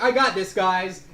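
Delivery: loud, high, eager hero shout; confident cleric. Acted. Cleric to her party before acting, confident shout. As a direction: call it out boldly, high and loud. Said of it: "and she's like".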